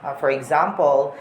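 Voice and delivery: ordinary delivery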